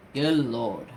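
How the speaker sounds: usual speaking voice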